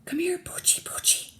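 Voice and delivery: high whispered dog call; coaxing whisper. Acted. Burglar calling the puppy over, whispering. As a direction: whisper sweetly to lure the dog. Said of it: "whispered the other".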